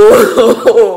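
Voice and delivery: real helpless laughter in pain. Real, not acted. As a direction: laugh at your own suffering.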